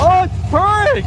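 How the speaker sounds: high, thrilled exclaim; beaming at the sound; excited delight. Real, not acted. Engine idling.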